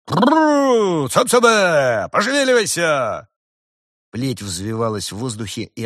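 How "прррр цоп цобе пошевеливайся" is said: high, loud drawn-out drover's calls; tireless shouting. A narrator's reading. Farmer waving his whip at oxen, shouting. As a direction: bellow over the creaking cart. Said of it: "без устали кричал".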